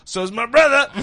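loud, high comic outburst; hammed-up cartoon character delivery; cartoon punchline voice. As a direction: blurt the punchline big and high. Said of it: "He goes".

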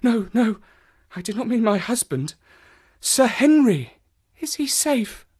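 high, anxious, weakened female character; distressed woman. Acted. Voices distressed Mrs Stapleton.

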